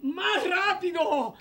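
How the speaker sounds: high, drawn-out demand; urging call. Acted. Host urging children to warn sooner, high slow call. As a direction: stretch it out, calling for speed.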